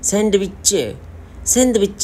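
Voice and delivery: Korean-style सैंडविच; Korean accent. Shown as a demonstration. Teacher showing Korean सैंडविच, Korean accent. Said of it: "लहजा है सैंडविच".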